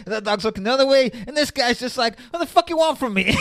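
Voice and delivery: hammed-up Pesci ranting at the painting; Pesci's painting rant. Mocking the painting at his mum's, loud hammed-up Pesci. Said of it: "Pesci's like".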